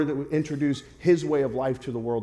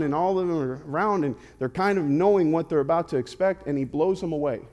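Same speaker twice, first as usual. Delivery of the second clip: ordinary delivery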